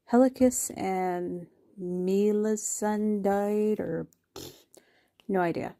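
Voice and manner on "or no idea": usual speaking voice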